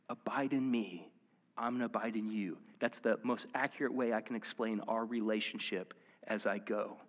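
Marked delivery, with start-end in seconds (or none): 0.1-2.6 soft intimate voice. Retold or imitated. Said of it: "it's like he's like".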